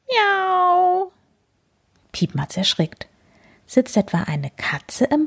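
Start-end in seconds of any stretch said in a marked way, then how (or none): 0.1-1.1 high, long drawn-out meow; cat meow. Acted. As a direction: mimic a cat, pitched way up. Jay imitates a cat. (hohes, gedehntes Miauen)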